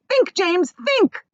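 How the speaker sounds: high, loud urgent plea; begging. Acted. Miss Spider begging James, high urgent plea. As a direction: beg him hard.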